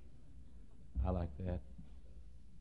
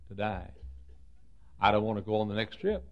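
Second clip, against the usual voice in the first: ordinary talk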